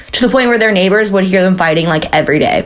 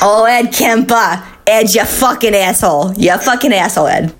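put-on Clarnell, New Jersey accent. Host imagines the mom berating her son, New Jersey accent. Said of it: "that's what Carnell sounded like".

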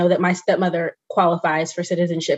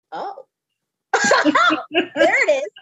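high, laughing mock discovery; gleeful teasing; 12 semitones higher, slower than usual. Grandchild catching grandmother's slipped accent, gleeful high teasing. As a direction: crow with laughter, pitched way up, drawing it out. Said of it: "and I was like".

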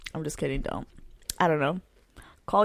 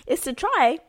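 customer service voice; 7 semitones higher, 8 dB louder than usual. Acted. Pitching cereal like Shark Tank.

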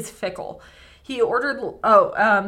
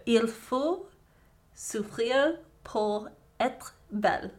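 slow, careful amateur French accent; attempted French accent; 2 semitones higher, 4 dB quieter, slower than usual. Shown as a demonstration. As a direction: try the line in a French accent. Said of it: "me saying it in a French accent".

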